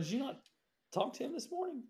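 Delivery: innocent question. Retold or imitated.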